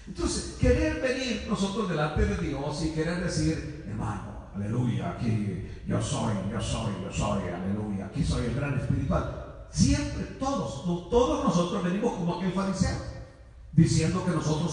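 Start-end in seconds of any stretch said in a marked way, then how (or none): 3.9-9.7 mock self-important believer. Mocking boastful believer before God.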